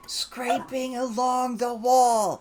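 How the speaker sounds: slow, high, loud repeat; drawn-out shouted correction; emphatic repeat. Acted. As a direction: spell it out loudly for someone who missed it. Repeating louder for Doc who missed it.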